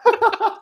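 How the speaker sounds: high burst of laughter. Real, not acted. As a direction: giggle high and quick. Friend teasing about games.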